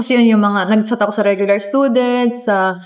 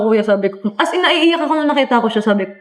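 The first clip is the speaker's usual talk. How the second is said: ordinary talk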